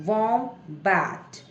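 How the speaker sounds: slow "वॉम्बैट", वॉम and बैट held apart; syllable by syllable. Shown as a demonstration. Teacher models breaking a long word, slowly in syllables. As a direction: stretch "वॉम्बैट" into two separate beats. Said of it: "syllable को break कर दीजिए".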